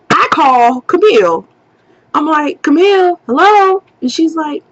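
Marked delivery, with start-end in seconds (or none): 2.6-3.8 calling out. Retold or imitated. Calling out to her friend.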